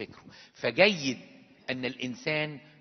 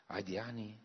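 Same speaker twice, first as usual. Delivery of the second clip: dismayed quiet echo; 4 semitones lower, 7 dB quieter than usual. Real, not acted. Priest echoes, dismayed.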